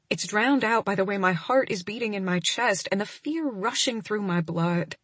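ordinary delivery

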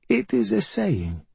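high, slow excuse from a bedridden mother; high-pitched, slow dodge; evasive mother. A narrator's reading. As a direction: brush off the accusation, high and drawn out. Mother dodging her son's accusation.

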